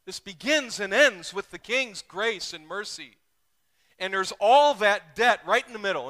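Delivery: loud, high preaching at full force. Acted. Pastor preaching hard to the congregation, loud and high. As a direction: preach it out to the whole room.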